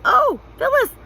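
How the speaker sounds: very high, loud despairing wail; husband begging his wife not to make him do it; squeaky, despairing. A narrator's reading. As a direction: plead in a squeaky, desperate voice. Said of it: "in a squeaky, despairing voice".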